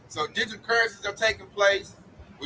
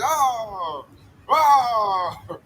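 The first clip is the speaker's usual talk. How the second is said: mock wail. Mocking the enemy after every loss, wailing. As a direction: cry out like a beaten loser. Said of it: "take an L after L".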